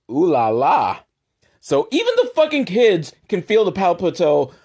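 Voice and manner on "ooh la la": put-on kid's ooh-la-la; teasing kid. Kid spotting the teacher smiling at Dolph, lightly voiced tease. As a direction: lightly imitate a kid teasing the grown-ups. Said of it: "one of the kids goes".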